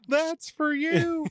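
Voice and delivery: high, slow wrestler catchphrase; hammy wrestling promo delivery. Pretend.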